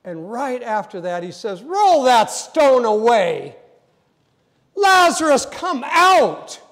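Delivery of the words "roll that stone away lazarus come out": loud, high, slow command; commanding call. Retold or imitated. Jesus calling Lazarus out of the tomb, loud slow command. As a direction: call him out of the tomb with full force.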